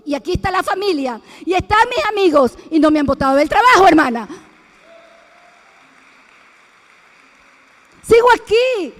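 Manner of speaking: loud, fast triumphant sermon; loud preacher's triumph; triumphant preaching. Acted. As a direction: proclaim it as victory, loud.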